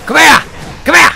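loud, high battle yells; fired-up fight shouts; excited shouting. Real, not acted. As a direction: yell at the enemy.

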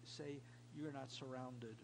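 ordinary talk

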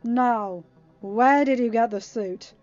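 loud, forceful interrogation; snarled warning. A narrator's reading. Uma interrogating the captive, snarled. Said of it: "Uma snarled".

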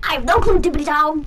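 high, loud goofy call; loud playful shout. Acted. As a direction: shout it out teasingly. Loud silly answer.

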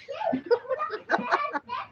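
high, soft run of giggles; giggling. Real, not acted. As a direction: giggle on and on at the compliment. Tickled by praise of accent. (mahabang hagikhik na mataas)

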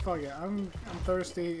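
usual speaking voice

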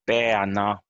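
"Bäna" stressed hard on the first syllable. Shown as a demonstration. Teacher shows learners where Sami stress falls, "Bäna" stressed at the start. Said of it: "the first syllable is normally stressed".

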